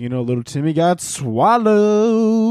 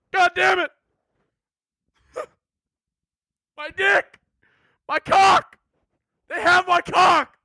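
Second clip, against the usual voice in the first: much higher, panicked mock yells; hammed-up pained yelling; 8 semitones higher, slower than usual. Swimmer attacked by piranhas, much higher mock panic yells. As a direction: yelp in comic agony.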